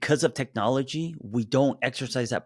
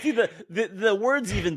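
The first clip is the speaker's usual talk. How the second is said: laughing; 10 semitones higher than usual. Real, not acted. Laughing at own forgetting.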